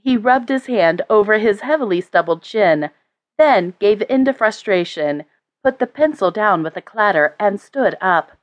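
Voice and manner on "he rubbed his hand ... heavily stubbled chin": ordinary delivery, nothing marked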